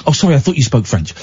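usual speaking voice

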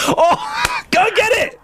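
very high, helpless squeal; laughing cry. Real, not acted. Host hears reporter left her bag behind, squealing with laughter. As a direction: blurt it out through real laughter.

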